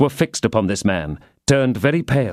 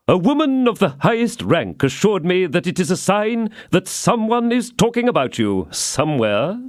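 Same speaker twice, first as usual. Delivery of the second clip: lightly voiced Porthos; 8 semitones higher than usual. A narrator's reading. Porthos voiced in read story.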